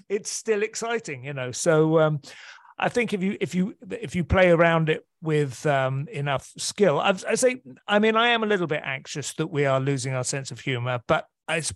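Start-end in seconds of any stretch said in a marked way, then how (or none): none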